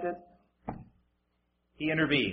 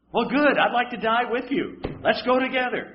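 higher, bright mock agreement; mock cheerful agreement; 6 semitones higher than usual. Preacher playing sarcastic Moses, higher, mock cheerful. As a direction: agree cheerily, tongue in cheek.

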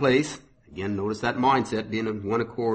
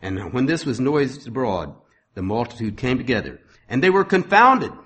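raised, ringing sermon reading voice; scripture reading; 3 semitones higher, 5 dB louder than usual. Acted. Preacher reading Acts to his congregation, proclaiming.

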